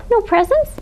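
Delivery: high squeaky disbelief; little mouse can't believe it; shocked squeak. A narrator's reading. Mouse aghast.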